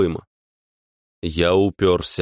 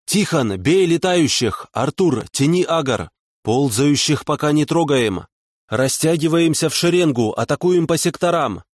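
shouted commands; 6 semitones higher than usual. Acted. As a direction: bark orders across the hall. Leader shouting battle orders.

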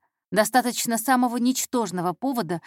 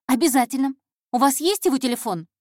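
put-on higher, louder, earnest girl; eager intern; 5 semitones higher, 4 dB louder than usual. Intern asking the boss for a phone number, eagerly. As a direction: jump at the suggestion eagerly.

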